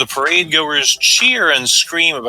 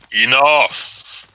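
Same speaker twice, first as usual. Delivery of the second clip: booming voice. Acted. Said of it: "a terrifying voice booms from the sky".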